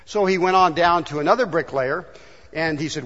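normal talking voice

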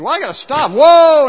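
rising into a loud, high holler; alarmed shout. Acted. Rider nearing the edge of a cliff, alarmed shout. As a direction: cry out to halt a runaway horse.

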